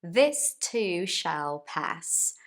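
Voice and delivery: slow, deliberate catchphrase delivery. Shown as a demonstration. Teacher spelling out her motto slowly. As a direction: lay it out slowly, word by word.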